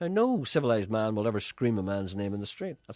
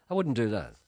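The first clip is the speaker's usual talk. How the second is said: ordinary delivery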